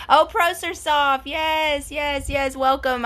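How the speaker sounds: excited greeting. Real, not acted. Greeting a viewer who joined.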